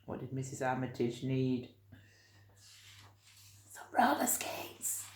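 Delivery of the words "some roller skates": put-on whispering the answer to children; whispered reveal. A narrator's reading. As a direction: share the secret with the kids.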